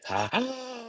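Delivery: high, soft sucked-back "ha ha"; inverse laugh. Shown as a demonstration. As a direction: laugh in reverse, high and light. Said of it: "like, an inverse thing".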